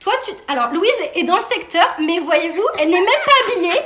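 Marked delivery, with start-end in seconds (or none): 2.8-3.8 fast, high, laughing it out. Real, not acted. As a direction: giggle through the line. Laughing about daughter in pyjamas.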